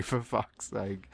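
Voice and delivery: usual speaking voice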